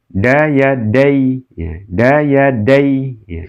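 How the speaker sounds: "dai" said slowly, with the "ai" short and unlengthened; lin reading demo. Shown as a demonstration. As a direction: read "Daya dai" slowly, like a Quran teacher. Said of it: "Nih contoh ini ya".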